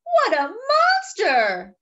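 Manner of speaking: high, loud and slow horrified cry. Acted. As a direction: call it out aghast at the beast. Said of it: "cries another".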